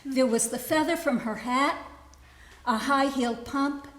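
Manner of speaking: deliberate recital, phrase by phrase; poetry reading. Acted. Poet reading a poem written for her mother, deliberate recital. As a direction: recite it line by line, setting out each keepsake.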